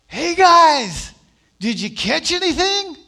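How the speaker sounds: high, loud call across the water. Retold or imitated. Man on the shore calling to the fishermen, loud high yell. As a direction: shout to the boat from the shore. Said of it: "he yells out".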